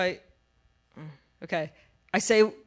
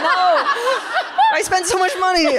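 laughing retort; 9 semitones higher, 5 dB louder than usual. Retold or imitated.

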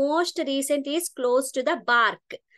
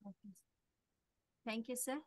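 much softer, faint reply; barely audible; 3 semitones lower, 20 dB quieter than usual. Real, not acted. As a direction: speak faintly, barely reaching the mic. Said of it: "Madam, your voice is very low".

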